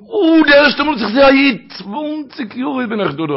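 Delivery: high, loud burst of joy that slows down; as if seeing the rabbi at last after long waiting; overwhelmed cry. Acted. Old man greets rabbi, overwhelmed.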